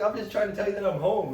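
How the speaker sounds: high, loud retold plea; acted-out frantic explaining, loud and high; exasperated protest. Retold protest to startled mom.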